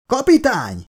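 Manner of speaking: high, urgent yell. A narrator's reading. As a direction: cry out for the captain. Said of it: "Ekkor valaki felüvöltött". (magas hangú felüvöltés)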